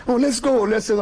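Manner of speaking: high, anxious urging; nervous urging. Retold or imitated. The guide urging Clive to leave, high and anxious. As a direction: hurry him out.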